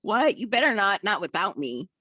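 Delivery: high, scoffing disbelief; mock outraged "what?"; indignant surprise. Husband objects indignantly.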